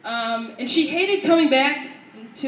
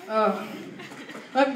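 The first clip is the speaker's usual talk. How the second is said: ordinary talk, nothing marked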